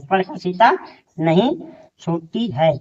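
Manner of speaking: each word set apart with long pauses; spaced-out classroom dictation. Shown as a demonstration. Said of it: "teacher dictates word by word".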